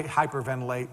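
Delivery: usual speaking voice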